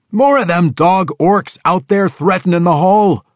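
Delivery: high, loud gruff yell. Acted. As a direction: shout it for him. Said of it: "Rollo yelled for him".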